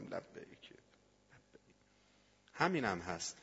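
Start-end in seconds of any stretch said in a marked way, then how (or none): 0.0-0.6 low, very soft echo; tender, fading response from above; God's gentle answer. Retold or imitated. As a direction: let the reply sink to a hush.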